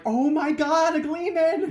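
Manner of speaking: high, gushing fan voice; awed excitement. Retold or imitated. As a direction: gasp it like a starstruck villager. Villagers' awe acted out.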